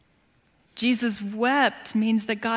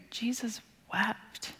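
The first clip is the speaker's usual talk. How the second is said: slow, unvoiced whisper, much softer; 10 dB quieter, slower than usual. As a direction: let the two words fall slowly in a hush. Verse repeated in hush.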